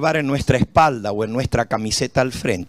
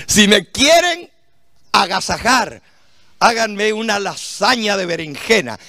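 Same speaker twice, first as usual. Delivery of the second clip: excited; 6 semitones higher than usual. Real, not acted. Excited about eggplant.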